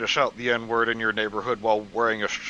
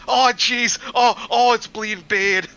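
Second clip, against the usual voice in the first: much higher, loud panicky whine; 10 semitones higher, 8 dB louder than usual. Retold or imitated. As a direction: whine in a panic over a cut. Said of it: "was his reaction like".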